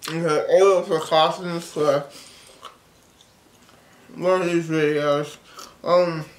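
talking around gumballs; mouth full. Real, not acted. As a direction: cheeks stuffed with candy. Seen 4: cheeks bulge, pink gumballs visible between lips throughout.

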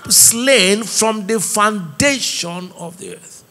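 slow, drawn-out recitation of the verse; scripture quotation. Real, not acted. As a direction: draw each word out solemnly. Quotes scripture in preaching.

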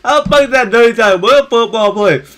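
heated argument. Real, not acted. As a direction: argue the call hotly. Arguing about the tackle.

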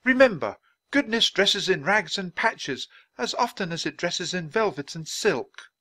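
high, ringing moral lesson; orating Dickens. Acted. Dickens gives the crowd a last word, orating. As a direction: deliver it loftily to the crowd.

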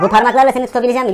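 ordinary talk, nothing marked

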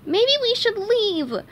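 hope nobody notices you're scared; a touch of shaky fear; trembling. Acted.

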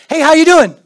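loud, high throwaway greeting; breezy passing hello. Retold or imitated. Preacher imitating a careless passing hello, loud and breezy. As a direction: toss it off while walking past.

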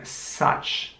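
ordinary talk

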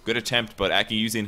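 rising hype of a live caster; excited play-by-play. Real, not acted. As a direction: call the fight as it unfolds, building. Live teamfight call.

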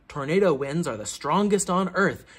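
usual speaking voice

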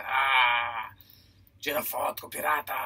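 gruff pirate call. Acted. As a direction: growl it like an old sailor. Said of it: "consigo fazer uma voz de pirata". (voz de velho marinheiro)

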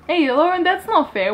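playful high-pitched complaint; mock protest. Real, not acted. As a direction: call her out, teasing. Protesting a cheeky fan question.

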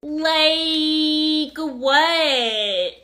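each word held absurdly long; mocking drawl. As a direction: innocently drag out both words. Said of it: "Stop that".

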